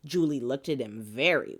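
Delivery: usual speaking voice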